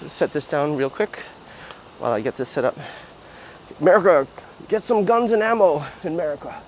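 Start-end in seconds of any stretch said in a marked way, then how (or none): 3.8-6.5 mock American. Mock gun-loving American.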